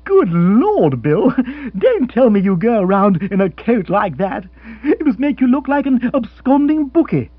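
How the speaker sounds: high, loud mocking dismay; mocking Rory. Rory mocking Bill's loud check coat, loudly. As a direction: tease him about the awful coat.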